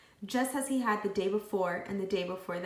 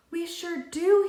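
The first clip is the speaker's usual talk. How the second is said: excited; 10 semitones higher, 8 dB louder, slower than usual. A narrator's reading. Todd's idea.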